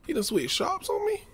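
high-pitched, stunned disbelief; shocked disbelief. Retold or imitated. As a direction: ask it like you can't believe he left you. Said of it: "I'm like".

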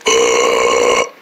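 low, rough throaty burp sound; fake burp. Boys burping after gulping drinks, deep burp. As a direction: force a long deep burp. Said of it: "We both did a burp".

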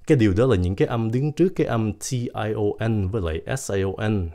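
ordinary talk, nothing marked

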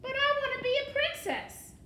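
put-on very high, loud girlish wail; wailing protest. A narrator's reading. Mig protesting to the rat, high wailing. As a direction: whine it out at the top of the voice.

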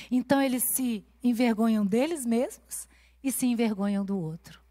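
usual speaking voice

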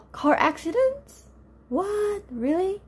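gasp, then a high shocked question; sudden alarmed disbelief; shocked surprise. Real, not acted. Student reports car accident.

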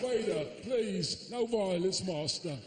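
put-on kung-fu film plea, Chinese accent; mock Chinese pleading. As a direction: beg slowly in a Chinese accent. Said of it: "I want proper Jackie Chan".

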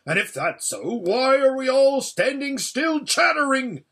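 loud, high rallying cry; roused, urgent dwarf. Acted. Dwarf urging creatures to send for the army, roaring. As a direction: rouse the idle crowd to action.

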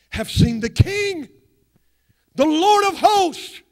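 slow, high, loud proclamation; thundering climax of Isaiah's vision; proclaiming. Acted. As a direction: lift it to the heavens. Climax of scripture quote.

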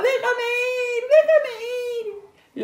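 high, loud squealed plea; frantic storybook squeal; piglet's yelling. A narrator's reading. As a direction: yell like a struggling piglet. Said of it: "pataleaba y gritaba".

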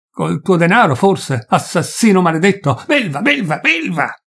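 high, loud name-calling; raging insults. Acted. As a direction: hurl belva at him. Peasant raging at forester.

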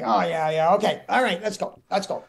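high, eager go-ahead; brisk Mickey Rooney. Retold or imitated. Mickey done with his script, briskly rallying the set. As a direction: rattle off agreement and push on. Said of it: "He's like".

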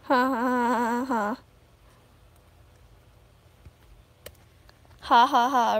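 dry sarcastic ha ha ha; annoyed laugh. Real, not acted. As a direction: fake laugh, unimpressed with chat.

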